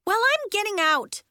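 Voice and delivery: high, loud declaration; determined. A narrator's reading. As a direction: announce it defiantly. Trapped girl resolves to escape.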